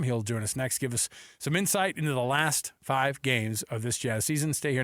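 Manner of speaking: ordinary talk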